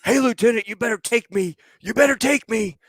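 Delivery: high, hammed-up claiming of the seat; mock loadmaster. Loadmaster hammily claiming the ride seat. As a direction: crow like a greedy kid calling dibs. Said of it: "I remember him going".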